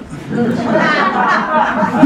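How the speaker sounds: a warm burst of laughter; amused chuckle; hearty laugh. Real, not acted. Laughs at own joke.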